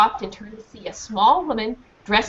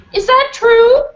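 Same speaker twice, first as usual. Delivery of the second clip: much higher and louder accusing question; shrill demand; 12 semitones higher, 8 dB louder, slower than usual. Acted. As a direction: demand the truth. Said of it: "Missus Crutch demanded".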